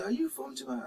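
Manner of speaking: high-pitched quoting of curious strangers; mock-quizzical question; people asking. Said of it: "that's what a lot of people say".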